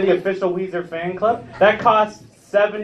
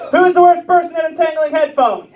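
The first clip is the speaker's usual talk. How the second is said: high, raised-voice demand to the crowd; insistent joke setup; 9 semitones higher, 3 dB louder than usual. Real, not acted. Comedian re-asks the crowd his riddle, insistent raised voice. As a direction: call it out higher, pushing for a response.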